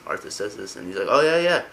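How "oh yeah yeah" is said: eager mock agreement, high and loud; pitched-up ingratiating yes; overly nice. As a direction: agree too readily to please. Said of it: "trying to be all nice".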